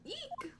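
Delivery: tiny high squeak; startled eek. Acted. Frightened game character reacts to monster hunt, squeaking. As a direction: let out a small, high-pitched eek.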